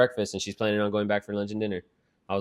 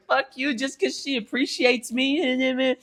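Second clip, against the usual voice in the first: high, drawn-out squeaky retort; mock indignant mom; 13 semitones higher, slower than usual. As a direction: imitate an affronted mom in a squeal. Acts out mom's indignant retort.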